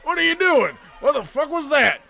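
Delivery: very high, loud startled yell; startled outburst. Acted. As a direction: yell in shock as you fade. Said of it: "He's like".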